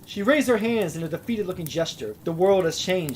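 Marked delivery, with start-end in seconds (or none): none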